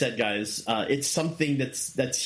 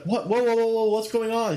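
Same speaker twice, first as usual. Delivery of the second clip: high, loud mock disbelief; imitating stunned fans, higher and louder; shocked crowd; 9 semitones higher, 4 dB louder than usual. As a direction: cry out what's happening as a stunned fan. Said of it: "they were like".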